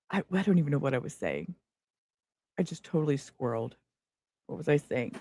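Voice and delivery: soft, fading, lost her thread; trailing off. Real, not acted. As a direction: drift off quietly, distracted. Lost track after the interruption.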